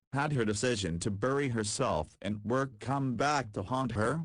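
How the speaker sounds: usual speaking voice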